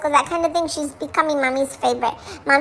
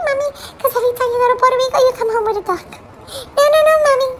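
fast high scolding; 7 semitones higher, much faster than usual. Acted. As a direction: rattle it off high and quick, scolding Mom. Scolding Mommy about trips.

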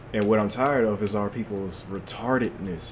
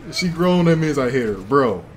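laughing repeat; 5 semitones higher, 7 dB louder than usual. Real, not acted. Repeats line laughing harder.

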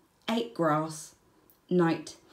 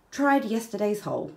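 ordinary delivery

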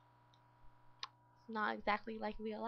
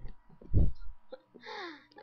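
big heavy groaning sigh; loud exasperated sigh; 9 semitones higher, 11 dB louder than usual. Real, not acted. Gamer sighing loudly after her meltdown, exasperated. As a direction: let out a loud fed-up sigh. Annoyed at game.

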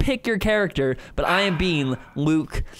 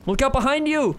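high urgent warning call; alarm shout; 8 semitones higher than usual. Real, not acted. Warning partner of danger.

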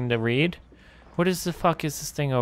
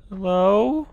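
normal talking voice

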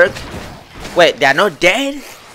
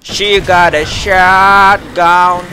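dramatic slow line; much slower than usual. Pretend.

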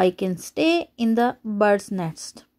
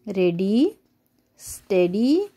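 ordinary delivery

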